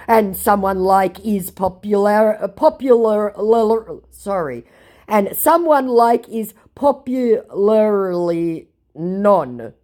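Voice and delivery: mock scammer voice. Quoting the scammer's garbled boast, slow and stumbling. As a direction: fumble every word like a confused scammer. Mocking scammer's broken English.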